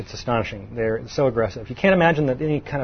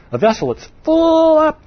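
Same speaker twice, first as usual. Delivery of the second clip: excited; 14 semitones higher, 5 dB louder than usual. Real, not acted. Vivid sermon image.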